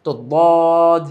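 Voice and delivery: বদ with a thick, heavy daad, stretched very slowly and loud; heavy Arabic daad. Shown as a demonstration. Teacher showing students the heavy daad, slow and loud. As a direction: hold বদ long and full, the tongue edge pressed on the molars. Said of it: "বদ এইভাবে কয়েকবার practice করব".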